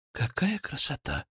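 soft, sighed wonder; hushed, dreamy exclamation; sighing. Acted. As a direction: breathe it out in admiration. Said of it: "вздохнула Вероника".

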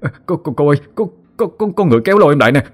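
high, fast stammering in fright; terrified stammer. A narrator's reading. A terrified boy calling to his teacher, high and stammering. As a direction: stumble over the words, too scared to speak. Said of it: "Nam ú ớ kêu lên".

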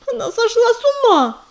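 high, loud despairing cry; anguished groan. Acted. Belka despairs that her sister has left the clan, a high anguished cry. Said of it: "простонала Белка".

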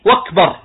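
"وكبر" said wakbar, W replacing the first glottal stop; hamza turned to waw. Shown as a demonstration. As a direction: start "وكبر" on a W sound. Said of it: "يبدل همزة أكبر واوا".

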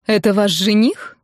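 plain reading voice

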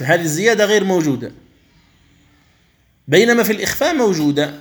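normal talking voice

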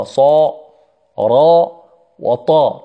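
صا، را، وطا with full, dark emphatic consonants; heavy emphatic letters. Shown as a demonstration. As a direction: sound out صا، را، وطا heavy, each one separate. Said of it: "فيها ثلاث حروف مفخمة". (نطق صا، را، وطا بتفخيم واضح)

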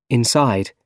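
usual speaking voice

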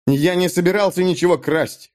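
exclaiming. A narrator's reading. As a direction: cry out in denial. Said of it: "воскликнул Натан". (Натан восклицает, оправдываясь)

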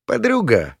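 high-pitched jeering call; mocking. A narrator's reading. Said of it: "насмешливо бросил царёк".

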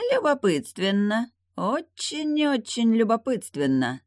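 high, slow, musing demon voice; thoughtful demon. A narrator's reading. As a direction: muse over it slowly. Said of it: "задумчиво произнёс демон".

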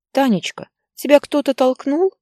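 high, anxious grandma asking her granddaughter; a grandmother's high, fretful question; worried grandmother. Acted. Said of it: "спросила у внучки бабушка". (бабушка встревоженно и высоко спрашивает внучку)

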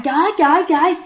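high, mock panicked cry of a mother; frightened mother calling out; mother crying out in alarm. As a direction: retell a mother shouting in alarm, pitch climbing at the end. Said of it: "mẹ bạn mới la làng lên".

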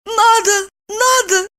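high, stern little woman's voice. A narrator's reading. Woman insists she must go to work, high stern voice. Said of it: "сурово ответила Липочка".

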